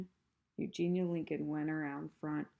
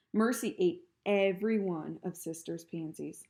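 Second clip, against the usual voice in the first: usual speaking voice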